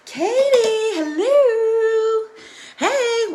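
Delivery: high, loud, sung welcome; sing-song greeting. Real, not acted. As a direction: sing the name out high and bright. Sing-song greeting to live viewer.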